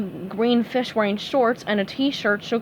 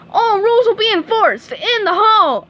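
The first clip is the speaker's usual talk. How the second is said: high, loud grand proclamation; heroic shout; 11 semitones higher, 7 dB louder than usual. A narrator's reading. SpongeBob proclaims to the class, loud and high. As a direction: declare it like a superhero.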